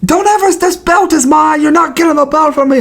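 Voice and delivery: very high-pitched trash-talker impression; Bisping. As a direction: hurl it as mock taunts. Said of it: "Bisping being like".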